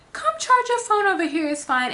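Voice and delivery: lightly voiced helpful guy; kindly attendant. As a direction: coax her like a helpful worker.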